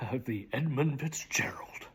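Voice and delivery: whisper. Acted. Dramatic poem recitation.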